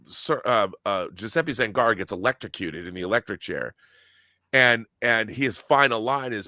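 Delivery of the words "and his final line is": ordinary talk, nothing marked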